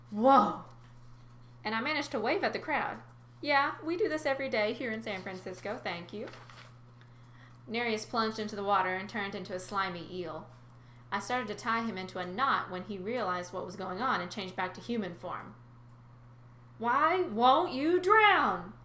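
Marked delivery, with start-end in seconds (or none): 0.1-0.6 crowd whoa. Retold or imitated. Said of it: "tourists went". 16.8-18.7 slow, high, loud wail; drawn-out wailing old man; wailing old sea god. Acted. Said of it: "he wailed".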